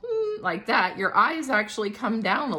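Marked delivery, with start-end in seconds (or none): 0.1-0.4 high hum through a smile; demonstrative high closed-mouth "hmm"; smiling hum. Shown as a demonstration. Art teacher showing how eyes move, high smiling hum. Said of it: "remember that when you smile".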